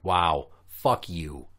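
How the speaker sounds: very slow, deadpan sarcastic; slow mock sympathy, deadpan. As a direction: drag it out, dripping with contempt. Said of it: "I'm like".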